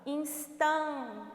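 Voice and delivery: "instan" stopped on a held "tan"; "instan" cut short after its stressed syllable; last stressed syllable shown. Shown as a demonstration. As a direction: say "instan", leaving off the final "-tes". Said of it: "última sílaba tônica".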